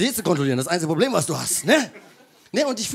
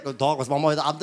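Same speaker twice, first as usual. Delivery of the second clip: put-on East German accent; 8 semitones lower, much faster than usual. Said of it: "Die redet halt so richtig Ostdeutsch".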